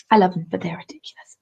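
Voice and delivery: quick breathy whisper; fast whispered tease; fond whisper. Real, not acted. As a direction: tease them under your breath. Teasing aside about northerners.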